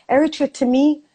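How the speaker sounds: normal talking voice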